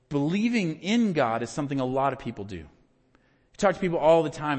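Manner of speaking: ordinary delivery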